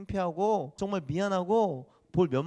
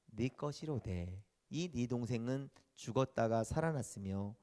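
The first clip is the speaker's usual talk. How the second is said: scripture reading; 4 semitones lower, 3 dB quieter than usual. Acted. Pastor reads the father's answer from scripture, solemn. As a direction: read the verse gently and solemnly.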